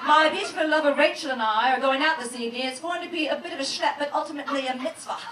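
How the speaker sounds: a hint of a posh British accent, played for laughs; mock posh British. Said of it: "I'd just be like".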